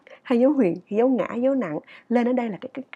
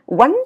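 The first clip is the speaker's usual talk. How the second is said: "won" short with a raised tone; wrong clipped syllable; 3 semitones higher, 8 dB louder than usual. Shown as a demonstration. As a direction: cut "won" short and high. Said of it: "Đừng đọc là". (đọc "won" ngắn, cao)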